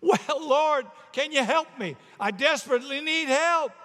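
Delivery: high, loud, panicky begging; desperate plea. Acted. Tourist pleading with the Lord from cliff, desperate. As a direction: call up for help in acted desperation. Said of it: "he's screaming to heaven".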